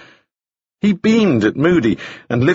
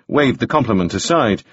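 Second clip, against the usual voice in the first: usual speaking voice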